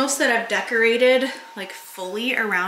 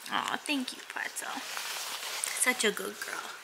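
much softer, slow, breathy praise for the dog; soft whispered praise; 12 dB quieter, slower than usual. Real, not acted. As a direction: whisper sweet praise to the pup.

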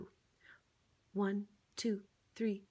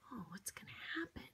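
fast, breathless whisper; hushed suspense; 15 dB quieter, much faster than usual. Acted. Teasing the child before the page turns, quick whisper. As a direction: whisper it like a secret.

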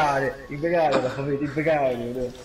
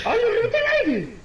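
high, loud dismayed cry; dismayed yell; 7 dB louder than usual. Real, not acted. Ball bounced away in game.

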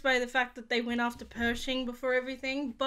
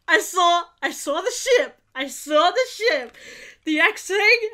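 excited; 5 semitones higher, 7 dB louder, slower than usual. Real, not acted. Excited at seeing the ship.

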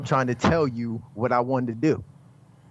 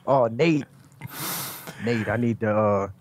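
lightly mocking impression of the boss; weird mocking voice; 2 semitones lower than usual. Champion mimicking the boss summoning others, light put-on voice. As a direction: mimic the boss's hesitant speech. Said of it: "keep doing that voice".

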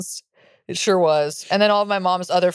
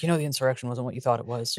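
lightly voiced dad, low and softer; dad in background; 7 semitones lower, 7 dB quieter than usual. Daughter voicing dad's background commentary, low muttered aside. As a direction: drop low and soft like a dad commenting off to the side. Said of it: "with my dad's voice in the background".

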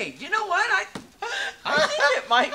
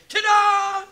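toilet announcer voice; 12 semitones higher, 8 dB louder than usual. Pretend. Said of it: "it's gonna say".

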